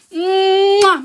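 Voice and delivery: loud, high "mwah"; big kiss sound. Real, not acted. Host thanks a viewer, loud smacked kiss. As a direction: blow a loud, exaggerated kiss.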